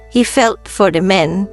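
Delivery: ordinary delivery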